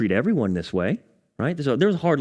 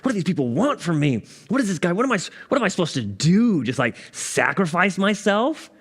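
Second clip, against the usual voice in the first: raised, mock-exasperated outburst; higher, frustrated questions piling up; retold frustrated rant; 6 semitones higher than usual. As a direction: vent like you did on the phone to dad. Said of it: "I was so mad".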